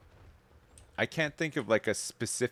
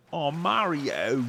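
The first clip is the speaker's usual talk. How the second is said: slow, higher cartoon Italian; Luigi voice; 5 semitones higher, 4 dB louder, much slower than usual. Luigi answering Mario, slow cartoon Italian. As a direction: stretch it out like Luigi.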